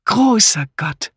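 high, drawn-out exclamation; exclaiming. Acted. Wife at breakfast to her husband, exclaiming. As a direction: cry out slowly in amazement. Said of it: "rief Misses Harmond".

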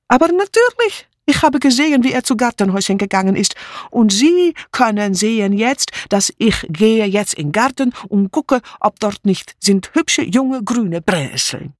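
high, pushed out, emphatic; emphatic refugee maid. Acted. Mitzi assuring the inspector, high and emphatic. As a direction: insist with great certainty.

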